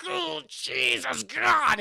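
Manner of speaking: high, slow, effortful cry; mock straining. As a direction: groan it out under a huge weight. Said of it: "he has a tough time".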